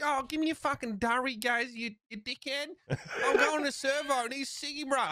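high, laughing broad Australian bogan; bogan Australian accent. Retold or imitated. Showing how most Australians really sound, broad Australian accent. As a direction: lay the Australian bogan accent on thick, grinning. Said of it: "Make the Australian accent a bit more cinematic".

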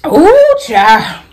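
high, loud cry of exasperation; exasperated exclamation. Real, not acted. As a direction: let out an exasperated ooh. Exclaiming sigh.

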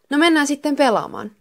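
rally your friends to start playing; eager. A narrator's reading. Miika's eager reply, acted. (innokkaasti, korkealla äänellä)

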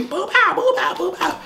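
very high rapid-punch mimicry; excited fight sound effects. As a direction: act out the blows in a high voice.

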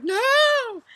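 high, loud alarmed "No!"; shouted no. Retold or imitated. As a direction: stop them dropping it. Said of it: "I was like".

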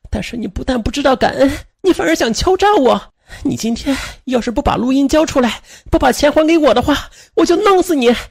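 high, strained, furious tirade; acted-out rant building into threats; angry scolding. A narrator's reading. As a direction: berate a betrayer at a high, sustained pitch. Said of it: "骂道".

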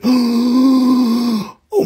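high, drawn-out mock gasp; shocked gasp. Seller voices the opal's startled face, shocked gasp.

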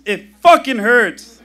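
loud, strained cry of pain, slowed; pained yell. Retold or imitated. Mother in labour yells at the paramedic, loud and strained. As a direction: yell it through the contraction pain.

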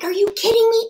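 high, excited "are you kidding me"; retold disbelief. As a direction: squeal it out in joyful shock. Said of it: "I'm like".

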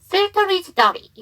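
"filter is" run together with a sounded R; linked R sound. Shown as a demonstration. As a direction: let the final R of "filter" slide into "is". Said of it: "모음으로 시작하는 단어가 나오면 연음이".

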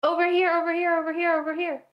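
calling out high and loud; calling from far away. Real, not acted. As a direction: shout to a friend across the map. Calling partner to the beehive.

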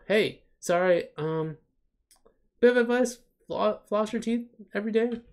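high, bubbly host voice; Missy voice. Acted. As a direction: play the chirpy child star.

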